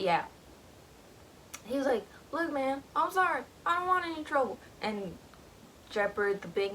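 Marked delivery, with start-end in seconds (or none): 2.3-4.6 pleading hunter. Retold or imitated. Hunter begging Jeopard.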